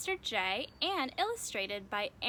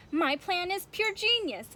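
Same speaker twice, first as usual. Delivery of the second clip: boastful; 7 semitones higher, 6 dB louder than usual. Acted. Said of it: "wasn't too humble".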